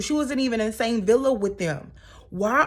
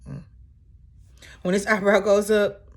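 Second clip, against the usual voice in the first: usual speaking voice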